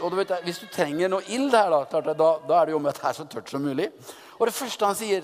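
ordinary delivery

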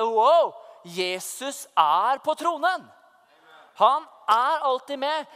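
kraftig, langsom proklamasjon; triumferende utrop. Retold or imitated. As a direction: utbryt det sakte og med kraft, som en åpenbaring. Reliving triumphant realisation, exclaiming. (triumferende, overveldet)

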